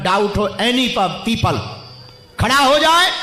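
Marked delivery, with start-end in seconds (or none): 2.4-3.1 loud, high rising call. Real, not acted. Preacher calls doubters to stand up, calling out. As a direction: ring it out across the stadium.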